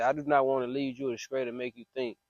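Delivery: usual speaking voice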